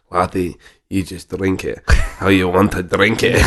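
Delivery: slow thick Scottish brogue; broad Scottish accent. Retold or imitated. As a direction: imitate a Scottish distiller, unhurried. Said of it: "With a thick Scottish accent".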